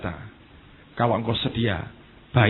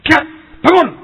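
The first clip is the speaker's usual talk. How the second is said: much higher, much louder cry to wake her; shouted command; 16 dB louder than usual. Retold or imitated. Yusak shouting at his dead fiancée to wake, loud. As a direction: call her back with a loud high shout. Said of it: "katanya itu".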